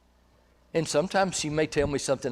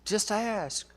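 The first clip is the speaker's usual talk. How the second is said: gently urging; 7 semitones higher, 8 dB quieter, slower than usual. Retold or imitated. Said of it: "I'll say".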